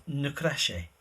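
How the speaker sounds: ordinary delivery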